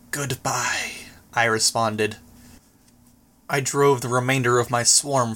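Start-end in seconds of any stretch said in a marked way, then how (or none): 0.1-0.9 soft unvoiced whisper; whispered goodbye. Skitter bidding the madman farewell, whispered. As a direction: breathe the goodbye out.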